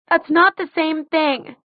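high, slow protest near tears; tearful protest. A narrator's reading. Daughter objects to his comparison, tearful. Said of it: "hear the tears in her voice".

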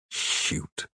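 disgusted mumble. A narrator's reading. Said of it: "disgusted, and mumbled".

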